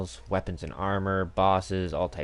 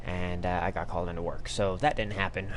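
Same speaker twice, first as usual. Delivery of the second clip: ordinary talk, nothing marked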